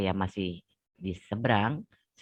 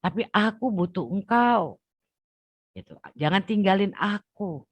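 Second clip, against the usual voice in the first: tearful plea; 9 semitones higher than usual. Retold or imitated. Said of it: "Itu saya udah nangis darah".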